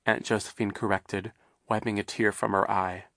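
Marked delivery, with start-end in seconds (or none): none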